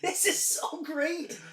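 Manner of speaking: high, slow delighted gush. Retold or imitated. Rewatching the favorite scene, slow high gush. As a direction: savor every word. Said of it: "I still was like".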